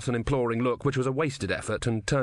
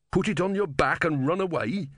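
higher, scornful question; sneering; 7 semitones higher than usual. A narrator's reading. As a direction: sneer at a ridiculous idea. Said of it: "sneered Simony".

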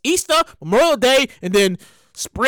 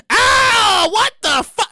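mock shocked yell; 9 semitones higher, 3 dB louder, much slower than usual. Coworker sees bank account emptied.

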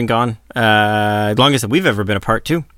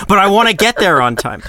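higher-pitched, half-laughing protest; mock protest; 9 semitones higher, 3 dB louder than usual. Husband defending his fast driving to his wife, mock protest. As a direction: protest like a hurried, defensive driver.

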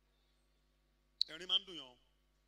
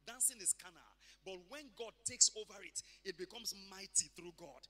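ordinary delivery

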